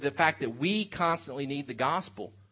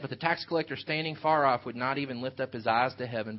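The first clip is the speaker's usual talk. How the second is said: ordinary talk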